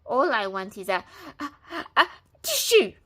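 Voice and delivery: wolf about to sneeze; sneeze building. A narrator's reading. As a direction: let the sneeze build on each a.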